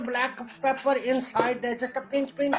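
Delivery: usual speaking voice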